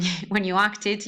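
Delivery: ordinary delivery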